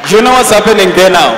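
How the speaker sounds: loud preaching question; preaching shout. Real, not acted. Preacher challenging the congregation, loud shout. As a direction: throw it at the crowd.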